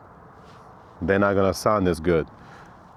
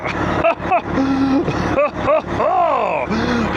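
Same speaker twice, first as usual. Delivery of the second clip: high falling hype laugh; excited laugh. Real, not acted. Hyped intro laugh.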